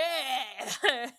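breathless giggle while playing Coffee; laughing fit. Real, not acted. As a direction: crack up helplessly. Cracking up mid-impression.

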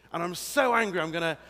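loud, high mock outburst; hammed-up rage; pretend anger. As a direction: wind up as if about to strike. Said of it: "say I get really angry with someone".

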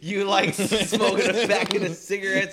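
slow, high, loud hammed-up scolding; angry parent. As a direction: bark it like a furious dad. Mock parent punishment.

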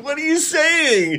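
high squeaky disbelief; baffled. Retold or imitated. Reacting to Dilton's rant, high incredulous squeak. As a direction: shriek it out in disbelief. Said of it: "And I'm like".

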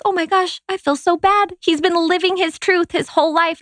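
high, gushing mock remorse; high-pitched, over-the-top guilty gush; guilty dismay. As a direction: gasp as if realizing you wronged your kid. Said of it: "And I was like".

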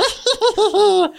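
very high clown cackle; very high creepy clown laugh. Pretend. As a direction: cackle like Pennywise from the sewer. Pennywise imitation.

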